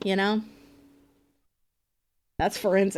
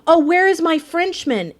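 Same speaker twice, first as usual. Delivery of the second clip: higher, louder mock cry of a worried sailor; imitating the sailor; 7 semitones higher, 7 dB louder than usual. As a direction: call out for the lost mate, hamming it up. Echoing the game sailor's line.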